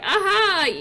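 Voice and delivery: lightly voiced Mulan, high and loud; a quick, high mock exclamation; acted Mulan. Mulan answers her family, high acted exclamation.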